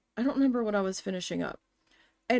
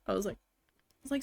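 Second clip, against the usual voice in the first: normal talking voice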